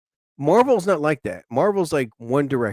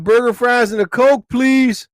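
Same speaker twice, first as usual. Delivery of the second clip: higher, louder order into the speaker; repeating the order louder to the drive-thru clerk; louder repeated order; 8 semitones higher, 5 dB louder than usual. Retold or imitated. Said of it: "I said".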